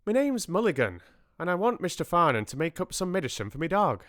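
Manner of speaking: high-pitched Irish brogue; brisk, rich Irish lilt; rich Irish accent. A narrator's reading. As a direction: introduce yourself in a thick Irish accent. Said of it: "spoke with a rich, unexpected accent".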